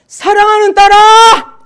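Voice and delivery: very high, loud, slow booming call; God's thundering voice. Retold or imitated. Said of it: "천둥 소리 막 같은 그 소리에서". (천둥 같은 하나님 음성)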